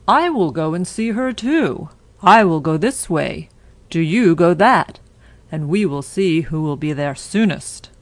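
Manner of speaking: put-on wolf daring the girl to a race; sly wolf. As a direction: play the wolf setting up his trick.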